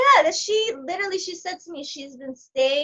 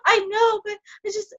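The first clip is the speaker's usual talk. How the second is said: much higher, loud, tearful complaint; imitating a crying caller; 10 semitones higher, 3 dB louder than usual. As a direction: whine it through mock sobs. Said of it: "crying caller retold".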